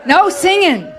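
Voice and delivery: laughing, high and loud; laughing protest. Real, not acted.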